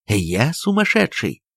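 usual speaking voice

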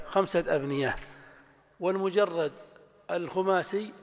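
ordinary talk, nothing marked

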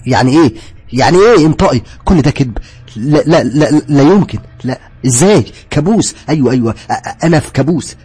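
frantic shouting. Acted. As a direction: break down, shouting and stammering.

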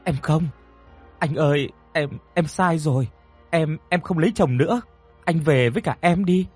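high-pitched, urgent begging; desperate pleading. A narrator's reading. Woman begging Tuấn to take her home, desperate pleading. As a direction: beg him desperately to take you along. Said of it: "dập đầu rồi van xin Tuấn".

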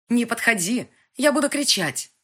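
high, loud frightened warning; scared girl threatening to scream; panicked shout. Acted. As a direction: scream to keep him away. Frightened girl warns him off.

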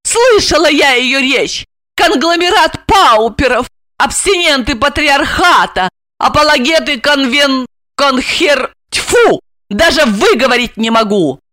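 high voice tripping over big words; mock the speech, stumbling in annoyance; scornful heroine. Asya mocks the speech, stumbles. (насмешливо, высоким голосом, запинаясь)

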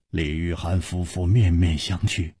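ordinary delivery, nothing marked

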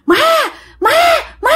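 short, separate calls for mother; cry for help. A narrator's reading. Terrified woman calling her mother by the bakery, crying for help. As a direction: call out in fright. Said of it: "la làng lên".

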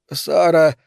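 plain reading voice

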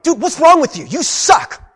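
loud, high outburst of exasperation; berating a child. Acted. Parent to a child not doing enough, angry yelling. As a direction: yell it at a kid who isn't doing enough. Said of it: "you go to a child and they're not doing enough".

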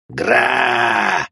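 drawn-out monster roar; demon roar. A narrator's reading. Demon lunging at its summoner, roaring. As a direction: roar wordlessly as you charge.

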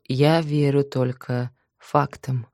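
ordinary delivery

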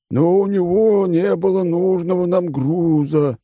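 high, slow, lazy condescending voice; bored rich glutton explaining terms; smug fat oligarch. Acted. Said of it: "возразил толстяк".

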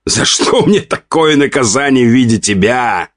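high-pitched theatrical woe; exasperated lament. A narrator's reading. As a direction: wail it in mock despair at a spouse. Laughing husband laments his wife.

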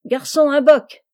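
high, forceful café order; shouting. A narrator's reading. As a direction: shout for a beer across the room. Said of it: "il cria de nouveau".

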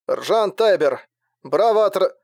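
shouting through a bad radio; crackly cordless phone. A narrator's reading. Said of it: "пробилось сквозь помехи".

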